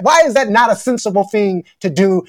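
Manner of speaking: usual speaking voice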